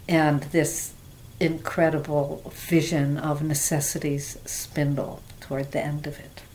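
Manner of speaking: normal talking voice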